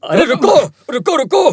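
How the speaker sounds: calling to stop. Acted. Calling after the drama artist to wait, urgent. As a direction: call out to make them stop.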